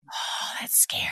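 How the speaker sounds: scared whisper. Real, not acted. Admitting her fear of judgment to friends, whisper. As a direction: breathe it out voiceless.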